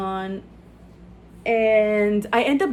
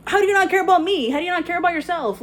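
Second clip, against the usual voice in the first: exasperated plea; 8 semitones higher, 5 dB louder than usual. Retold or imitated. Retold frustration at uncaring man.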